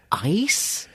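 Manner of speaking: mimicking a bewildered girl, high upswing; confused question. Girl at the rave asked for ice, bewildered. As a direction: draw it up into a baffled question. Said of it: "a very terrified look on her face and so confused".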